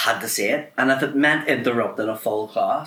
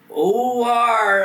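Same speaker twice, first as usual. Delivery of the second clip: loud, higher, mock culchie farmer drawl; culchie farmer accent; 9 semitones higher, 5 dB louder than usual. Science teacher spelling for the class, mock culchie accent. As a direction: bellow it in a broad farmer's accent. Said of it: "big fucking stupid fucking culty accent".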